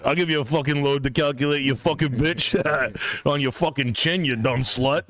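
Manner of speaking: lightly voiced foul-mouthed tough guy; a hint of a crude buddy barking insults, laughing; crude car character. Car-ride bit between friends, mock crude tough guy. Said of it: "This is a character we do in the car".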